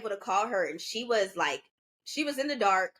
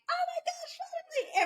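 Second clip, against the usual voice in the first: much higher, softer squeal of relief; excited friend; 4 dB quieter than usual. Retold or imitated. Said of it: "Peyton was like".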